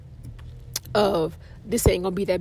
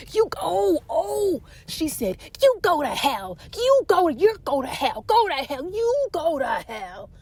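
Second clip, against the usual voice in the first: higher, mock angry cussing; imitated cursing; 6 semitones higher than usual. Angry patient telling the older man off, mock furious. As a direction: hurl it at him, hammed up. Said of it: "she was building the seat".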